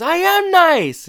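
high, loud, drawn-out protest; mock indignant. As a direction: wail a hammed-up self-defence. Retold protest against teasing.

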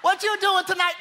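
high, loud pleading imitation; hammed-up woman begging a man out; mock pleading woman. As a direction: beg like a pushy date.

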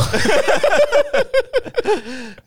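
loud, very high burst of laughter. Real, not acted. Host cracking up at the light tank joke, loud laugh. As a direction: crack up helplessly.

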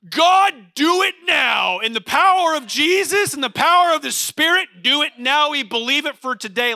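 high, shouted prayer, imitating a charismatic; fervent charismatic prayer. Charismatic church member praying for someone, shouting fervently. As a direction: cry out to God at full force. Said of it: "Someone in the charismatic church will be like".

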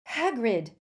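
shouted out loud in surprise; bellowing. A narrator's reading. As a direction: call his name across the corridor. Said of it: "Harry bellowed".

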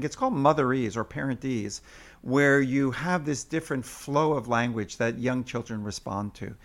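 ordinary delivery

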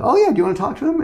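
bright, high, breezy offer, imitated; cheery sister. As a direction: sing it out like nothing's wrong. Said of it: "She's like".

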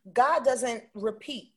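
ordinary talk, nothing marked

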